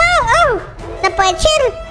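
ordinary delivery

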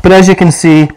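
speaking through mask filter; muffled through a gas mask. Real, not acted. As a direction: voice behind full face mask. Seen 2: Full-face gas mask worn throughout; R frames show bare face, masks handheld.

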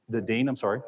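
usual speaking voice

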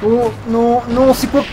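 high alarmed outburst; startled by a sudden monster; shocked. Real, not acted. Dragon boss appears suddenly. (surpris et alarmé)

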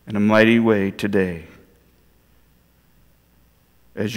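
low, soft congregational prayer. Real, not acted.

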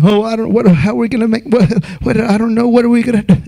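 fast, fretful mock worrier; hammed-up flustered worrying; mock panic. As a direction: flap about like a panicked worrier.

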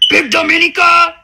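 loud mock announcer call of the name; mocking shout. Mocking the rival by calling out her name.

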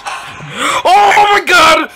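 slow, drawn-out panicked shout; terrified scream. Real, not acted. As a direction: scream in real terror. Monster appears suddenly.

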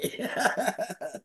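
high, soft little laugh; quiet amused laugh; light laugh. Real, not acted. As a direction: chuckle at yourself. Laughing at her own line.

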